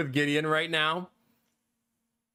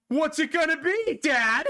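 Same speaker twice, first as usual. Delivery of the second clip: put-on high, louder cheeky kid taunt; defiant kid; 10 semitones higher, 3 dB louder than usual. Kids taunt dad about an empty threat, cheeky and laughing. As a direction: dare your dad, laughing. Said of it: "they'd probably be like".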